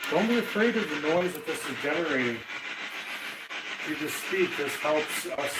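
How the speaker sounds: usual speaking voice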